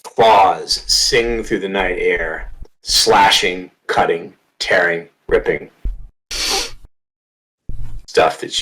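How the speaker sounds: slow, dramatic narration with heavy pauses; game master's scene setting. Acted. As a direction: punch the key words, then let each phrase fall away.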